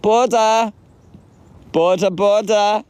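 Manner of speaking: high-pitched, drawn-out Welsh-style "Butter"; attempted Welsh pronunciation. Shown as a demonstration. Showing off a Welsh word, high attempted Welsh accent. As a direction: sing "Butter" out slowly in a high Welsh lilt. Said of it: "Butter means good in Welsh".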